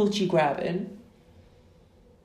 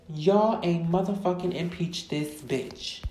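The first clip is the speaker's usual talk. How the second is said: ordinary talk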